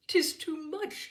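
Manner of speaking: high, slow and overjoyed; overwhelmed with happiness, almost disbelieving; liveliest emotion. Acted. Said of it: "acknowledged with the liveliest emotion".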